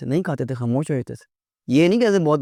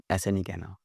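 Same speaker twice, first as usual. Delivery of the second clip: normal talking voice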